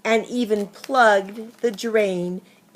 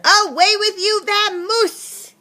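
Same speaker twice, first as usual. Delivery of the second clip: higher, louder get-out cry; angry shooing; 10 semitones higher, 5 dB louder than usual. Acted. Bear shoos the mouse out, high angry shout. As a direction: drive the mouse off.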